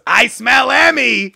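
high, drawn-out impression of a script supervisor; Bridget. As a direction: call it out slowly in her put-on voice. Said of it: "Whose voice is that?".

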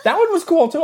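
says it through laughter; laughing high and bright. Real, not acted. Laughing at Galaxy joke.

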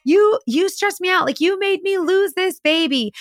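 high, lightly whined blame; lightly voiced blaming woman; mock accusing girlfriend. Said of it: "you can clearly hear her say".

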